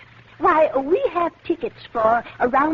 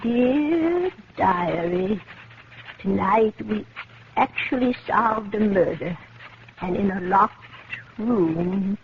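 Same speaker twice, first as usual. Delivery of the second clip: slow, lower, measured reading aloud; a slow, satisfied diary read; diary dictation; 4 semitones lower than usual. Acted. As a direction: savour each word as you write it. Writing diary, reading aloud slowly.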